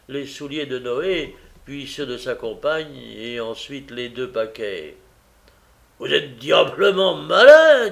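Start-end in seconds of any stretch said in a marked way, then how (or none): 6.0-7.9 impressed. A narrator's reading. Noah flattered.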